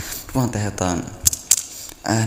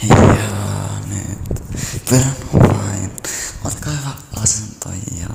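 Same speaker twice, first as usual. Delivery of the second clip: guided relaxation; 4 semitones lower than usual. Acted. Asking viewers to breathe deeply, hushed guide voice. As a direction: coax the listeners to breathe deep.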